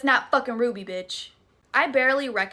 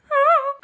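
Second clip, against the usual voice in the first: big laugh; 9 dB louder than usual. Real, not acted. Laughing at her own fright.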